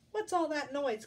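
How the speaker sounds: high, loud, lightly voiced hen; a hint of a fussy hen; clucking hen. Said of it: "clucked Henny Penny".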